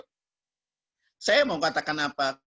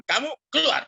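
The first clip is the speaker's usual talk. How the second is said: firm, higher-pitched command to get out; retold eviction order; 10 semitones higher, 4 dB louder than usual. Preacher ordering a congregant out, retold eviction command. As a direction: re-enact throwing someone out. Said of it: "Saya usir".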